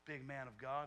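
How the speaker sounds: mock-respectful sarcastic taunt; sarcastic Pharisees. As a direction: needle him with fake respect. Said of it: "this is all very sarcastic".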